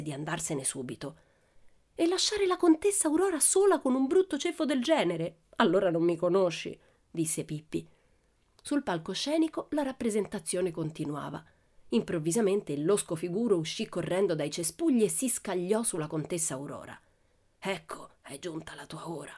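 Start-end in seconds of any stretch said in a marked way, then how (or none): 1.9-6.8 defiant. A narrator's reading. Pippi defies the theatre director. 17.6-19.3 soft menacing hiss through the teeth. A narrator's reading. Villain threatening the countess, hissed through teeth. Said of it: "sibilò tra i denti".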